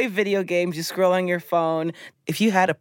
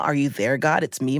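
ordinary delivery

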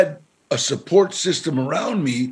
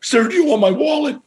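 mock scared stranger; 11 semitones higher, 4 dB louder, faster than usual. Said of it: "And he would say".

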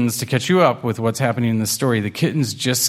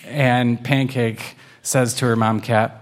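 plain reading voice

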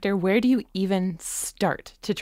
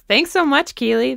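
ordinary talk

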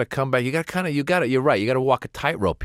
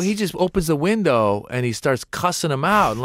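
normal talking voice

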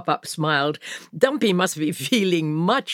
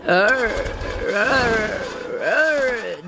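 complaining engine groan; 5 semitones higher, much slower than usual. A narrator's reading. Truck Dumpy complains as the key turns, groaning revs. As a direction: imitate an engine struggling to turn over. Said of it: "Dumpy complained".

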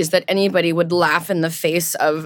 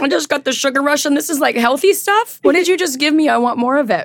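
excited; 7 semitones higher than usual. Real, not acted. Sugar rush from cookies.

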